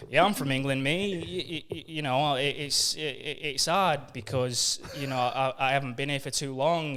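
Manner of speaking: broad West Yorkshire English accent. Shown as a demonstration. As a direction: speak like a Yorkshire lad, mate. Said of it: "it's a real West Yorkshire accent".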